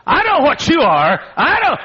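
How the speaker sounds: high, loud shriek of accusation; retold scream. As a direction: scream it at him across the plane. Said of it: "And then she screamed out".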